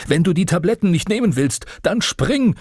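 viel höher und lauter hinterhergebrüllt; brüll ihm auf den Balkon nach; gebrüllt. Acted. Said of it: "brüllte er mir hinterher". (Vater brüllt dem flüchtenden Sohn hinterher, viel höher und lauter)

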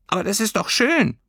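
high little creature voice; gently puzzled consolation; high creature voice, mild; comforting creature. Acted. Heimweh voice.

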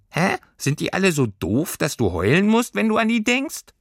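high voice climbing through the question; squeaky puzzled creature. A narrator's reading. Creature baffled by girl crying over family, squeaky. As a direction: pipe it up, baffled.

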